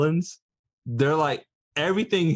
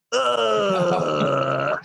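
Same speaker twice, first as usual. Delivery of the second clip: long drawn-out tearful groan; hammed-up wail of heartbreak; mock sobbing groan; 5 semitones higher than usual. Reacting to the terminally ill girl's promise, mock sobbing.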